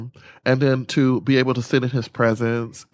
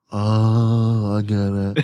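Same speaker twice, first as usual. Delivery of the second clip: realizing; 4 semitones lower, much slower than usual. Retold or imitated. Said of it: "And I was like".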